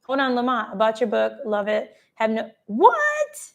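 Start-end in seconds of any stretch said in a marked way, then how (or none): 2.7-3.5 high, drawn-out "what?"; soaring surprised cry; astonished. Real, not acted. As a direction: squeal it in stunned disbelief. Reacts to surprise news in chat.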